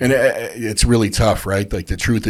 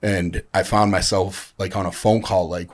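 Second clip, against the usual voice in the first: ordinary talk, nothing marked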